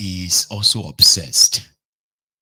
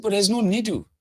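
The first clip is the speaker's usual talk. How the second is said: dismissive reply; 13 semitones higher than usual. Retold or imitated.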